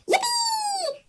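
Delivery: very high, soft squeal of joy; cheering. A narrator's reading. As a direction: squeal it with glee on the bridge. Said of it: "they cheered".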